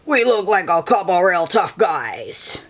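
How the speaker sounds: gruff put-on tough guy; grandma hamming a tough guy voice. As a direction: growl it like a movie tough guy. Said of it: "In a tough guy voice".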